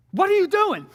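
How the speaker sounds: high-pitched mock call-out; imitated confrontation. Stepdad confronts ex outside.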